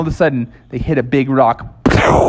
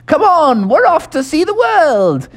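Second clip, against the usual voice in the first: put-on high, hearty old English toad; jolly Mister Toad; 13 semitones higher than usual. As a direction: boast like a merry old gentleman toad. Mister Toad voiced in story.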